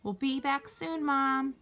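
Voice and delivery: high call into the next room. A narrator's reading. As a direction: sing out to Mom in another room. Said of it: "Jack called into the living room".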